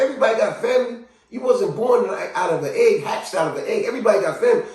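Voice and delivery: high-pitched scoffing onlooker; mock incredulity. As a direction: insist as if it's absurd.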